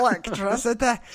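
high, hammed-up Scottish "Electricity"; mock Scottish accent. Host repeating his friend's word for fun, exaggerated Scottish accent.

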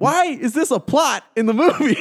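high-pitched, loud, laughing incredulity; exasperated disbelief. Retold or imitated. Movie fan griping to co-hosts about a subplot, exasperated. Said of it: "I'm like".

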